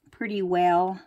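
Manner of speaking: ordinary talk, nothing marked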